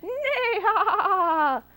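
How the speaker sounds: high loud horse whinny laugh; whinny the laughter like a horse; neighing laugh. Acted. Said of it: "The horse said".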